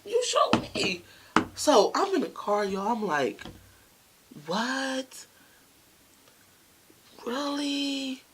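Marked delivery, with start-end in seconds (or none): 0.1-1.0 very high-pitched mimicked accusation; retold girlfriend's high outburst; acted accusation. Acts out girlfriend showing evidence. 4.4-8.2 high, drawn-out reaction; acted-out astonishment, pitch held high; stunned disbelief. Storyteller acts out stunned reaction.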